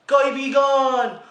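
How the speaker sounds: slow, drawn-out mock announcer voice for a navigation app; mock nav voice ad. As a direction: sell it like a silly radio ad, slowly. (mabagal na boses ng patalastas na pabiro)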